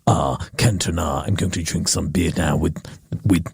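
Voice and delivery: deep, scornful boardroom boss; a low, blustering executive putting the intern in his place; low pompous boss. Pretend. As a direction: lord it over the intern. Boss character scolding intern.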